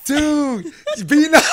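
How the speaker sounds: high, loud, laughing; laughing plea. Real, not acted. Driver telling the other to be nice, laughing loudly. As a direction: beg through laughter.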